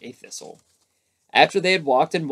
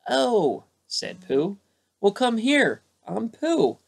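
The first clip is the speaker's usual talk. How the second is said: high little bear; 9 semitones higher, slower than usual. A narrator's reading. Pooh answers in the night.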